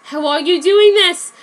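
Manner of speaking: high, loud pained demand; dying detective demanding answers; forceful. A narrator's reading. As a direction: force it out while in agony. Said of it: "asked L forcefully".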